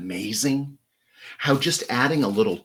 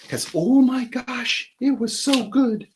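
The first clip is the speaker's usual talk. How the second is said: slow, much higher squeal of delight; gushing delight; 13 semitones higher, slower than usual. Real, not acted. Raving about the gumbo her friends served, slow high squeal. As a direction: swoon over the food in a high voice.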